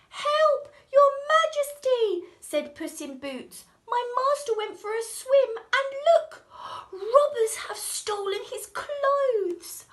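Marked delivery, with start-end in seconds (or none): 0.1-2.3 much higher, loud cry for help; calling for help. Acted. Cat calls out to king. 3.9-9.8 high, loud, urgent plea; urgent pleading. Acted. Cat pleads with king.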